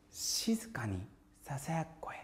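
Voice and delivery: whispered phrase. Shown as a demonstration. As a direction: say it in a hushed whisper.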